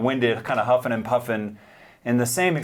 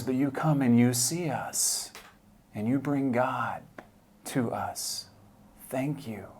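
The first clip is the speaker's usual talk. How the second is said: soft, slow and near-whispered; a hushed, moved retelling of his thanks; hushed gratitude; 3 dB quieter, slower than usual. Retold or imitated. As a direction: hand over heartfelt thanks quietly. Retelling a homeless man's grateful words.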